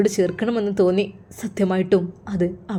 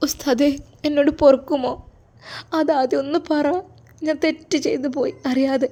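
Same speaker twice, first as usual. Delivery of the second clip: raised, pleading voice near tears; acted tearful plea; 6 semitones higher than usual. A narrator's reading. Daughter confesses her mistake to the ustad, tearful. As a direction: beg forgiveness as if crying. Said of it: "നീ കരയല്ലേ പെണ്ണെ".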